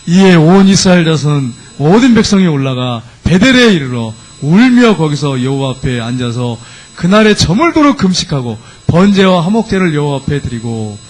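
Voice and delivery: slow, measured congregational reading; scripture reading. Acted. Preacher reading the verse with the congregation, measured scripture reading. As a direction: read the verse aloud slowly and deliberately.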